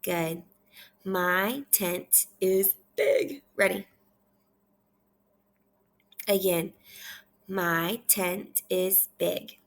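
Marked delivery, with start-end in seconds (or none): 1.0-3.4 slow, separated words with a lift on big; teacher's measured reading; slow read-aloud. Shown as a demonstration. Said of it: "I want you to repeat after me". 7.5-9.6 very slow, even word-by-word reading; measured repeat-after-me delivery; slow read-aloud. Shown as a demonstration. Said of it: "I want you to repeat after me".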